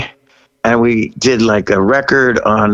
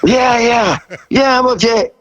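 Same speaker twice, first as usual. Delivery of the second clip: high-pitched, rushed reassurance; a flustered kid insisting he's fine; nervous eager yes; 10 semitones higher than usual. Retold or imitated. Young singer answering Dick Clark, high and flustered. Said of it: "And I'm like".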